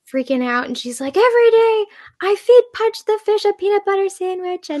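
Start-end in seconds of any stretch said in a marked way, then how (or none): 1.2-4.8 put-on high, loud little-girl meltdown voice; imitating a child's furious tantrum; Lilo's tantrum. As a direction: wail it like a six-year-old losing it. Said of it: "she has that big tantrum".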